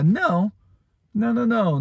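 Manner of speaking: high insistent mock no no no; stubborn protest. Believer arguing with God, high insistent no.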